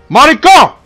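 loud, high shout of a name; calling out angrily. Real, not acted. Player shouts after Mariko as she walks away, loud. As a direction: yell after someone walking away.

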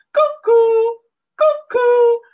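very high, loud cuckoo-clock call. A narrator's reading. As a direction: sing it like a cuckoo clock popping out. Cuckoo clock sound in story.

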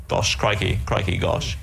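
lightly voiced stammering English gent; lightly voiced bumbling charmer; Hugh Grant. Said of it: "Hugh Grant".

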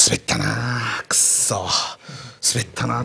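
a soft, low, grumbling self-reproach; quiet sulky grumble; muttered regret. Retold or imitated. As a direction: mutter it to yourself after bombing. Partner muttering after bombing.

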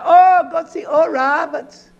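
high put-on fan's cry; mock gullible follower. Preacher mimicking folks flocking to a healer, mock excitement. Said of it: "he had the folks be".

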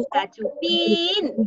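Cachupín called out high, PÍN held long; calling the dog. Shown as a demonstration. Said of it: "Llama a Cachupín".

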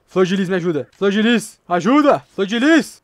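loud pleas, climbing in pitch; calling for help. Real, not acted. Chama a flor de lis por ajuda. (gritos de socorro cada vez mais agudos)

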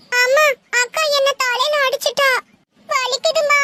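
put-on tearful child tattling to mother; playing up the hurt in a high whimper; put-on crying. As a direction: whine it like a hurt little kid. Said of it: "அம்மா, இவன் நடிக்கிறாமா".

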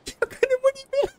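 very high-pitched panicked squeal; panicking gamer. Real, not acted. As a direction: screech it in total panic. Runs out of ammo mid-fight. (hoch kreischend, panisch)

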